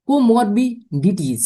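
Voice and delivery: comorbidity drawn out syllable by syllable; slow model word. Shown as a demonstration. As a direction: spell out comorbidity slowly for the class, each syllable clear. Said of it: "ये very important".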